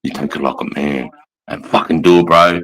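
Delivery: low, hard command to a recruit; low, intimidating barked order; barking drill instructor. Retold or imitated. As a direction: growl it down at him like a drill instructor. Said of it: "you grab his fucking arm and go".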